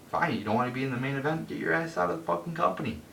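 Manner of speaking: fast furious rant; angry dismissal. Real, not acted. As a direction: spit it out, fed up and furious. Angry rant telling Batista leave.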